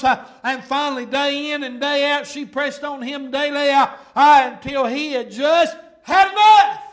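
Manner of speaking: loud, building pulpit cadence; full-force preaching. Acted. Preacher telling how Samson was worn down, building preaching.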